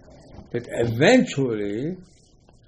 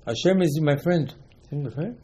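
ordinary talk, nothing marked

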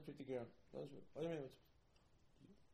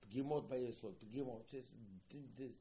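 ordinary talk, nothing marked

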